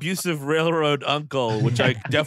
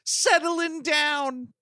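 much higher, teasing mock-delighted voice; mock congratulation; 10 semitones higher than usual. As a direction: coo playful congratulations up high, dragging out the ending. Jokey congratulation of the marrying leprechaun.

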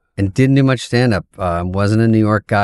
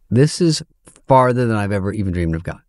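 ordinary talk, nothing marked

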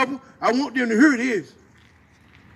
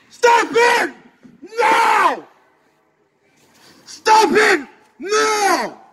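angry shout; 4 semitones higher, slower than usual. Real, not acted. Speaker rallying crowd to stop the hate, furious shout. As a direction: hammer each word out at the crowd in real anger. Defiant rally speech against violence.

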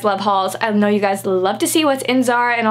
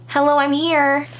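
ordinary delivery

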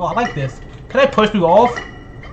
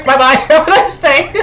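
laughing taunt, higher; laughing goodbye; 7 semitones higher, 7 dB louder than usual. Real, not acted. Laughing bye to the player he pushed. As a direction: gleefully wave them off while laughing.

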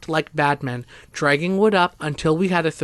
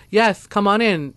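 higher, moderately raised welcoming call; yelled; 5 semitones higher, 4 dB louder than usual. A narrator's reading. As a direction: call someone over, a mild acted yell. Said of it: "Jackson yelled".

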